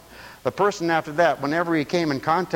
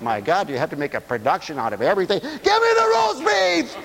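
exasperated mother shout; 3 semitones higher than usual. Retold or imitated.

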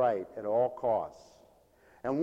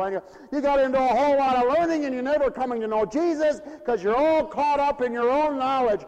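higher, louder rapid-fire rebuke; preaching rebuke; 11 semitones higher, 7 dB louder than usual. Acted. Preacher rebuking proud believers, forceful pulpit rebuke. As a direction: scold the flock in full flow.

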